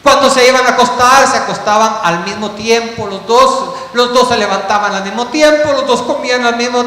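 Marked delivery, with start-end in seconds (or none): none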